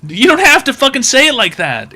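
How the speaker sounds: loud, high, exasperated complaint; indignant rant. Acted. Comedian scolds the cashier, indignant and loud. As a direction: complain at him like he's crossed a line.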